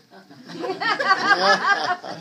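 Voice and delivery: big loud amused laugh; loud burst of laughter. Real, not acted. Laughing at the claim about Obamacare, loud laugh.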